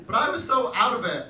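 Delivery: normal talking voice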